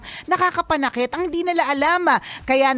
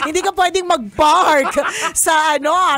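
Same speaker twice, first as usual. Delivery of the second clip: laughing; 3 semitones higher, 4 dB louder than usual. Real, not acted. Teasing cohost about huge horns while laughing. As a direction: giggle the joke out.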